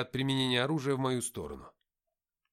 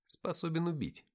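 ordinary delivery

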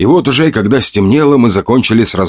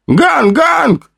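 joyful yell. A narrator's reading. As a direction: shout the good news. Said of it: "раздался радостный вопль".

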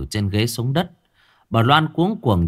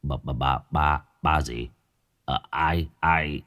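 soft, lower, stunned stammer; 6 semitones lower, 4 dB quieter than usual. A narrator's reading. Father stunned by the news, soft stammering. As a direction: stumble over every word in shock. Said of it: "Ông Hai Sung lắp bắp".

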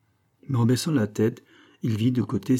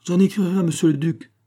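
higher, louder, grandly dignified; majestic; 9 semitones higher, 5 dB louder than usual. A narrator's reading. Priest stalls those demanding the weapons, majestically. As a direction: declare it with lofty authority. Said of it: "fit majestueusement l'abbé".

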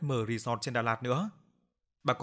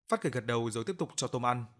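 plain reading voice

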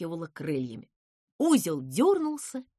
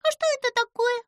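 quick, high-pitched wondering question; high, wide-eyed bafflement; puzzled surprise; 5 dB louder, faster than usual. A narrator's reading. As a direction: ask it fast in a high little voice. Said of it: "удивилась Чуча".

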